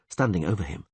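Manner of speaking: usual speaking voice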